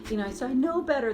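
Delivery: hint of panicky inner child; mock panic. Shown as a demonstration. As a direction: spiral into worry. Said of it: "that starts to panic about it".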